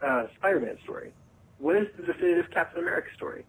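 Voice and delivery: ordinary talk, nothing marked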